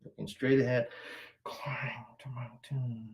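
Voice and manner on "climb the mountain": strained whisper. Real, not acted. Coach straining through a stretch.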